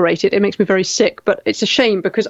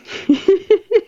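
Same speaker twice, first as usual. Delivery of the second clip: short giggle up high; high laugh; 10 semitones higher than usual. Real, not acted. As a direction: laugh off the comment lightly. Amused at chat comment.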